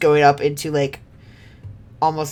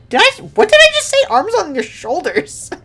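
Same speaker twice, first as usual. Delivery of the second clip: much higher, fast and loud disbelief; surprised; 14 semitones higher, 5 dB louder than usual. Real, not acted. Streamer catching her own slip, high and startled.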